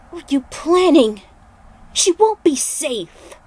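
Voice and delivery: heated accusation; angry. Acted. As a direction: press him hard for answers. Liu confronts Raiden over Katana.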